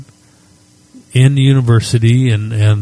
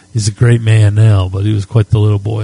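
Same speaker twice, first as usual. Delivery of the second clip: ordinary talk, nothing marked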